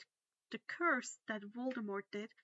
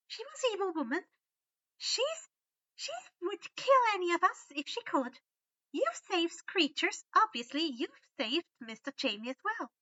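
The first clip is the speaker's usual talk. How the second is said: high, loud elf insistence; fierce house elf; 8 semitones higher, 7 dB louder than usual. A narrator's reading. Winky defends Harry, high fierce elf voice. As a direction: convince him he did right.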